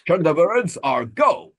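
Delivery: loud, slow TV title announcer; announcer catchphrase. Acted. Podcaster riffing on puppet shows, mock-heroic announcer. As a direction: boom it out like a show's opening. Mimics TV show's famous countdown catchphrase.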